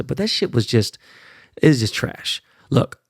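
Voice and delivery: ordinary delivery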